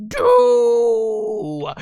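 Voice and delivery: high, loud cartoon cry of a name; shrill character shout. Majin shouting his brother's name with glee. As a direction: yell the name like an excited cartoon kid.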